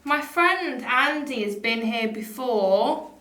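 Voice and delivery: loud, slow address to the spirits; calling out to spirits. Acted. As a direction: announce yourself to the empty room. Addresses spirit loudly, slowly.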